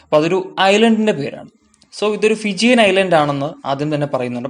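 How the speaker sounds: usual speaking voice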